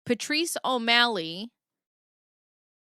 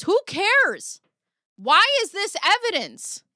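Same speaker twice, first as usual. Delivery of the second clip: much higher, louder incredulous jeer; exasperated mockery; 11 semitones higher, 6 dB louder, slower than usual. Dismissing the email as evidence, high exasperated scoff.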